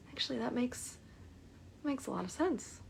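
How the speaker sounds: soft breathy whisper, dawning realization; hushed aha moment, almost to herself. Retold or imitated. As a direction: whisper it as the idea sinks in. Client slowly realizing, wheels turning.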